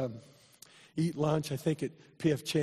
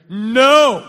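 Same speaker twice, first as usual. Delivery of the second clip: loud, high shouted no; 8 semitones higher, 18 dB louder than usual. Retold or imitated. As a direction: belt out a defiant no. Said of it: "She didn't care how many nurses would hear".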